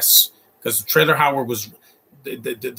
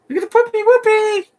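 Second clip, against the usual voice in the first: high squeaky cartoon voice, loud; excited high squeal at the puppy; silly cartoon voice; 6 dB louder than usual. Repeat even higher.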